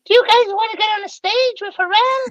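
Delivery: very high falsetto impression; imitated squeaky voice. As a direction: mimic him in a sky-high, comic falsetto. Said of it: "this is his voice".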